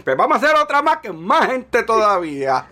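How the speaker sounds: imagined organizer. Acted. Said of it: "si tú haces otra y dices".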